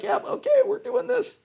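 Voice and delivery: squeaky high, eager voice; high, gleeful bring-it-on voice; mock challenge. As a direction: play a hunter who can't wait to take on a grizzly. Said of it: "Just be like".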